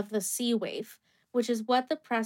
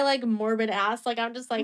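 ordinary talk, nothing marked

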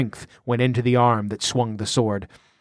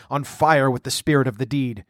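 plain reading voice

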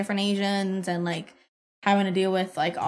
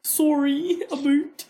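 higher-pitched Canadian "Sorry, a boot", vowels exaggerated; mock Canadian accent; 5 semitones higher, much slower than usual. Friend echoing the speaker's words, mock Canadian accent. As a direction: parrot it in a teasing Canadian accent. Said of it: "the Canadian accent".

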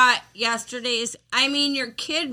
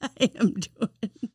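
said through giggles; can barely hold back laughter; laughing; 4 dB quieter than usual. Real, not acted. Still laughing at hiccups.

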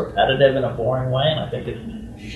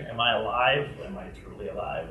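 hushed musing; 4 semitones lower, 10 dB quieter than usual. Retold or imitated. Pondering existential question.

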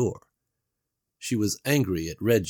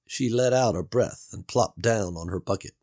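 ordinary delivery

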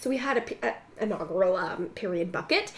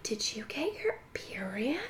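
hushed mock-secret whisper; whispered question; 11 dB quieter than usual. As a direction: whisper it like a nosy friend. Friend asks secretly, acted out.

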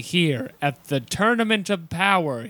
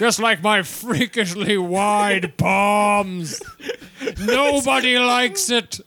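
laughing slow mock voice; 3 semitones higher, slower than usual.

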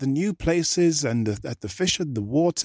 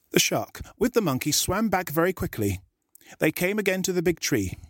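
ordinary delivery, nothing marked

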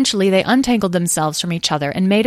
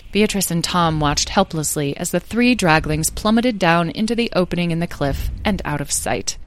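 ordinary delivery

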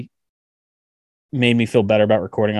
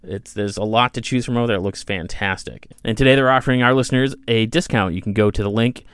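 ad read. Acted. Reads sponsor ad.

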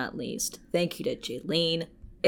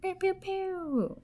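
squeaky laser pew pew; high "pew pew" sound effect; 6 dB quieter, slower than usual. Pretend. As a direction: toss in a playful high laser noise. Playful cheer after patron name.

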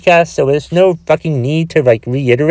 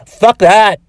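defiant retort; 4 semitones higher, 4 dB louder than usual. Real, not acted. Rejecting self-help advice.

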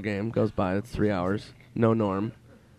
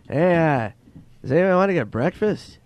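impression of an odd, hopeful buddy, pitched up; Norm Macdonald; 6 semitones higher, 5 dB louder than usual. Comedian texting the tour group about breakfast, imitated higher. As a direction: imitate the comic, higher and drawn-in. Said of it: "Norm goes on the mass text".

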